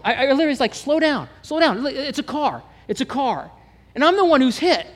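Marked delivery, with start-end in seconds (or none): 0.8-3.5 much higher pleading to calm him; urgent calming. Retold or imitated. Calming the cursing driver, urgent and high. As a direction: talk a panicking man down, high and quick.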